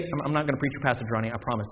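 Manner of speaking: low, soft, hurried aside; hushed throwaway promise; quick aside. Real, not acted. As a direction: toss it off under the preaching. Joking aside to a colleague.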